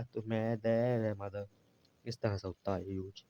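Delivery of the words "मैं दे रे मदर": मदर slowly broken into मैं, दे, रे, then whole; sounded out. Shown as a demonstration. Teacher shows how मदर is read, slowly syllable by syllable. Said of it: "यहाँ ए पढ़ा जाएगा".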